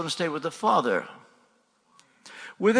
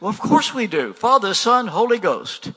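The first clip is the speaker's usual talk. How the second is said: higher and louder, offended protest; lightly voiced churchgoer protesting, louder and higher; indignant word people; 5 semitones higher, 5 dB louder than usual. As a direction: play the offended believer, a touch mocking. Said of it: "you can't get word people to see".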